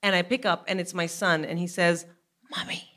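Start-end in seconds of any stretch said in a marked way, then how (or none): 2.5-2.8 put-on hushed child's call on the phone; secretive, softly whispered; imitated whisper. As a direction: whisper it as the son calling for mom. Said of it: "Why am I still whispering".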